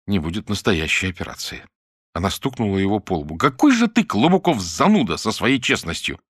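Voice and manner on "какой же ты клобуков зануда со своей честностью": playful scolding. Acted. Mira scolds Klobukov playfully.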